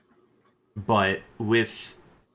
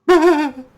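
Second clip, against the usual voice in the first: manic laugh; 13 dB louder than usual. Acted. NPC laughs after grim line.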